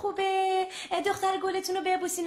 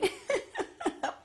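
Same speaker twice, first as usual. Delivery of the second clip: laugh; 9 semitones higher, 7 dB quieter than usual. Acted. Caller laughs at the wrong number.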